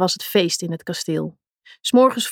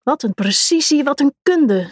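mock commentary; 6 semitones higher than usual. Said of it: "zei Begonia".

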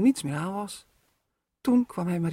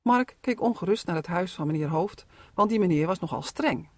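plain reading voice

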